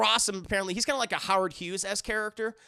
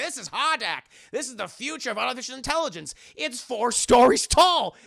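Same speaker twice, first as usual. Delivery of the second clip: proud, higher Southern bluster building loud; Southern accent; 5 semitones higher, 7 dB louder than usual. Acted. Rossum showing off Hardak, boastful Southern accent. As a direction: boast in Southern accent, booming the last phrase. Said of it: "He has a very thick Southern accent".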